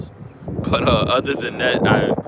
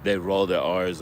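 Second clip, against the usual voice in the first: ordinary talk, nothing marked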